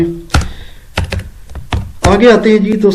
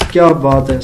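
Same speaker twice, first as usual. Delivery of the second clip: normal talking voice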